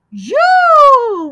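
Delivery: you said high and loud; model the word. Shown as a demonstration. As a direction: call out you for the class to copy.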